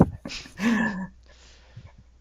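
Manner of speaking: high, breathy burst of laughter; real laugh. Real, not acted. Co-host cracks up at the accent bit, real laughter. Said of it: "if we're going with stereotypes".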